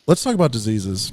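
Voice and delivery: low, flat deadpan pitch; mock-grim deadpan; mock-serious. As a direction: fake a grim topic change, low and dry. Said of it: "No, I'm joking".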